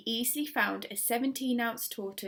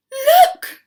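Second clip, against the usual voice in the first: sudden high excited shout; 18 dB louder than usual. A narrator's reading. Missus Silver spots Alfie stuck, shouting. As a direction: cry out in alarm. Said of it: "Missus Silver was shouting".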